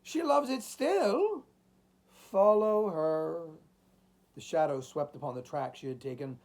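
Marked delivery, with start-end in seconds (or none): 0.0-3.6 high, long-drawn desperate plea; agonized entreaty. A narrator's reading. Old man begging the chimes to follow her, agonized. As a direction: beg with anguish, stretching the words. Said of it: "in agonized entreaty".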